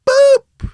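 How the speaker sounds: very high short boop; high squeak sound effect. Retold or imitated. Imitated sound of the shot.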